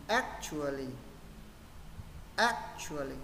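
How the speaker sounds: brisk whole-word actually, stressed on ac; quick, joined up. Shown as a demonstration. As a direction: actually run together as one word, stress first. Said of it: "và sẽ đọc nhanh".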